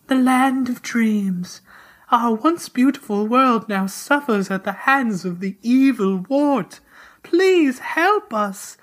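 high, slow, ethereal voice; quiet dream voice. Acted. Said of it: "A quiet voice spoke to Mario".

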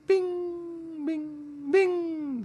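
very high pinging chime sound; ping it out like a crystal bell; high ringing bing. Shown as a demonstration. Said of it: "the pinging and ringing sound".

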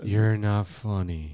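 slow, deadpan mock-calm scolding. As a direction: drag it out in fake calm. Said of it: "Very calm, very soothing".

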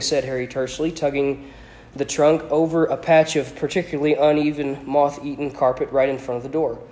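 ordinary delivery

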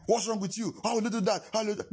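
high-pitched mock scolding. Acts out scolding his father.

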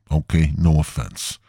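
usual speaking voice

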